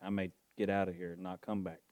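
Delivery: ordinary talk, nothing marked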